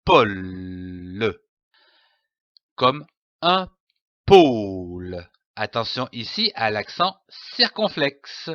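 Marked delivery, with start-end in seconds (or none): 0.0-1.4 pôle sounded out as PO-LLL, then LE; low, stretched pôle in two syllables. Shown as a demonstration. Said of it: "L'association de ces deux syllabes donne le mot". 4.3-5.3 pôle with a long, low, closed O; drawn-out O. Shown as a demonstration. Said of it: "On traîne le O".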